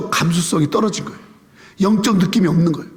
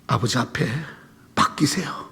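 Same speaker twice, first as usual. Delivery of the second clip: whisper; 8 semitones lower, 7 dB quieter than usual. Real, not acted. Whispered instruction.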